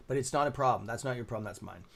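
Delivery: normal talking voice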